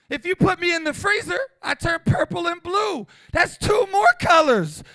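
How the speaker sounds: usual speaking voice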